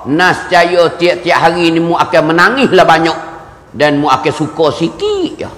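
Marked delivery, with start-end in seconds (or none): none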